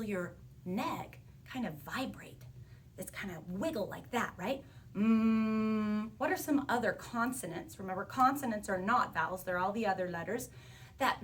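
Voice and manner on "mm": long, level humming "Mm"; sustained voiced sound. Shown as a demonstration. Teacher showing a vibrating neck, sustained "Mm" hum. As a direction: hold "Mm" as a steady buzzing hum. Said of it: "say the sound mm".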